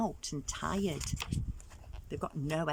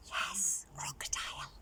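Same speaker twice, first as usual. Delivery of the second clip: whispered, softer and secret; storyteller whispering the trick's next victim; 4 semitones lower, 8 dB quieter than usual. Real, not acted. Hushed reveal to the children.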